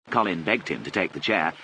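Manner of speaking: usual speaking voice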